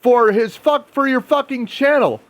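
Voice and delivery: shrill-free high mock outburst, very high; high-pitched emotional outburst. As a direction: squeal it out in a hammed-up emotional rant. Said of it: "she had an emotional outburst saying".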